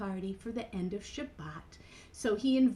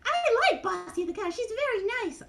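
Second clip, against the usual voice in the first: high puppet voice; Mimi; 11 semitones higher, 3 dB louder than usual. Acted. Mimi says she likes the cow, high puppet voice. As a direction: pipe up sweetly as a tiny character.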